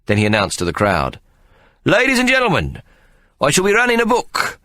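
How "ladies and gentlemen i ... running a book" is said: grand announcement. Acted. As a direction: proclaim it like a ringmaster. Ikey announces book.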